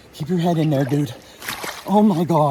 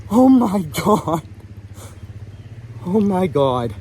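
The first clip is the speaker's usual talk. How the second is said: awed outburst; 6 semitones higher, slower than usual. Real, not acted. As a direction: stretch it out in sheer awe. Admiring the caught trout.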